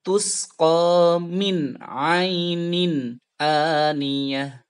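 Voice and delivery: very slow chant with thin hamzah; slow tajwid chant; Quran recitation. Shown as a demonstration. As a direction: sing it slowly, hamzah light. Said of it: "Bacanya seperti ini".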